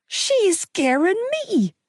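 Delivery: high slow whining accusation; whiny accusation. A narrator's reading. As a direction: whine it like a scared kid. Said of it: "Gibbsy accused".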